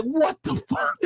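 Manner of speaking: high shouted disbelief; retold shock. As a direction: yelp it like the news just hit. Retold reaction to Trump winning.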